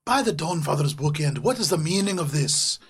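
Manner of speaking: loud, startled dwarf challenging intruders; dwarf scholar, indignant. Acted. Dwarf scholar scolding party crawling out from desk, loud indignant. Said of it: "they say".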